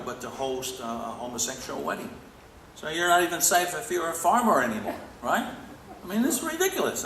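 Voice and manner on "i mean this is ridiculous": ridiculous. Real, not acted. Speaker exclaims at the news.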